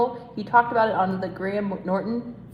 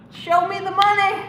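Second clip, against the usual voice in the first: higher, louder hammed-up catchphrase; famous movie line yelled for fun; mock movie shout; 10 semitones higher, 6 dB louder than usual. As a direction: belt it like the movie scene.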